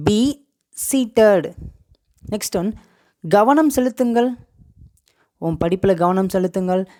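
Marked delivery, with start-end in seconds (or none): 0.1-1.5 "Be", long pause, then "seated"; word-by-word model. Shown as a demonstration. Teacher shows learners "be seated", slowly split apart. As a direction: split it into two separate words.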